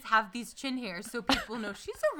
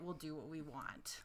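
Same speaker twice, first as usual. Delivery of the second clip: very soft, low mock aside; dismissive stylist aside; 7 semitones lower, 18 dB quieter than usual. Stylists privately brushing off a client's request, hushed aside. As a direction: drop to a near-whisper, imitating the stylist.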